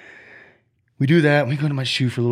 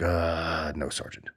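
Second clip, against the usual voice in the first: sheepish; 5 semitones lower, 6 dB quieter, much slower than usual. Retold or imitated. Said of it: "He's like".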